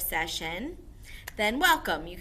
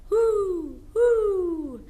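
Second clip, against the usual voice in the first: high, loud hooting owl; 12 semitones higher, 9 dB louder than usual. Shown as a demonstration. Teacher shows the class an owl's hoot. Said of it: "Owls hoot".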